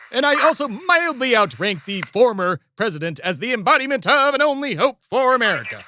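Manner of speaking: high-pitched, pompous protest; indignant pomposity. Acted. Host being searched by Secret Service, pompous indignation.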